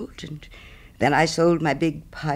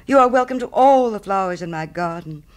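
raised, louder generous offer; little Hans eagerly giving way, pitched up; eager cry; 5 semitones higher, 6 dB louder than usual. Acted. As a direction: burst out with willing generosity. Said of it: "cried little Hans".

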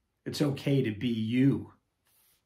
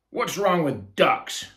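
demanding; 7 semitones higher, 8 dB louder, slower than usual. Acted. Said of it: "demanded a ninja".

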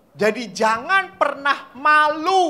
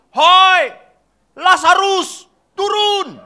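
much higher and louder yell; shouting call; 9 semitones higher, 11 dB louder, much slower than usual. Retold or imitated. Catechist as Jesus shouts at the man in the tree. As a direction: bellow up into the tree. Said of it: "Yesus berteriak".

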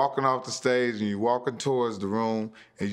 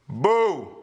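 loud, higher mock boo; imitating a boo; 7 semitones higher, 6 dB louder than usual. Comic imitating a heckler booing him, loud mock boo. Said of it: "another person say".

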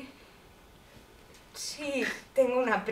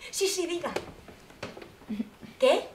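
normal talking voice

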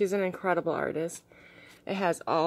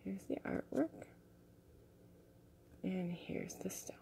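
whispering; 15 dB quieter than usual. Real, not acted. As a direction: hush it like a secret. Whispered presenting stone.

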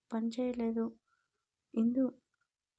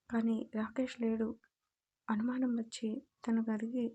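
ordinary delivery

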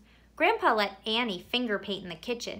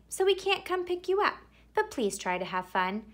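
plain reading voice

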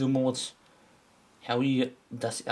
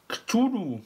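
surprised; 10 semitones higher, 3 dB louder than usual. Real, not acted. Recognizing the name.

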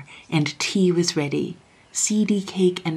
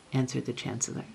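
ordinary delivery, nothing marked

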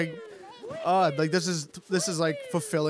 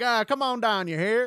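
higher Southern plantation drawl; 6 semitones higher than usual. Retold or imitated. Friend voicing Bowser as a Southern plantation guy, Southern accent. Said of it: "southern plantation guy".

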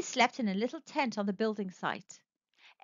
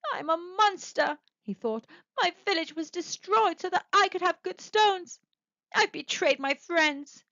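anguished self-reproach; 7 semitones higher than usual. Acted. Guilt over his destroyed village.

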